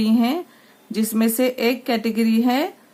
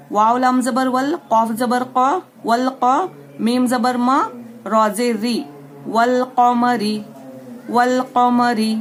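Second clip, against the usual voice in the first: syllable drill; slower than usual. Shown as a demonstration. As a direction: sound out each part, then join it. Spelling out syllables for class.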